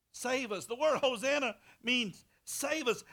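loud, high, rising preacher's cry; fiery preaching. Acted. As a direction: preach it hard at the congregation. Preaching again.

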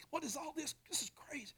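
whispered amazement. Retold or imitated. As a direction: breathe it in wonder. Mary's bewildered whisper.

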